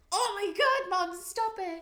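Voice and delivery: high, loud embarrassed protest; eye-rolling teen whine. Retold or imitated. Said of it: "And I was like".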